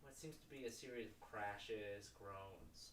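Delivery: hushed game master building dread; suspenseful narration. Acted. Game master tells players the noise returns, hushed suspense. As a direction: draw out the danger quietly.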